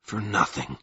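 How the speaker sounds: low, soft weary groan; a tired groan of defeat. A narrator's reading. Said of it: "groaned Covenant".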